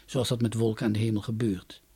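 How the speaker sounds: usual speaking voice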